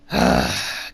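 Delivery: long low weary sigh; heavy sigh. Real, not acted. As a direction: let out a deep tired sigh. Heavy question about weaknesses.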